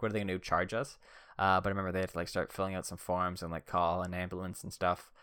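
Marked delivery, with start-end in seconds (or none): none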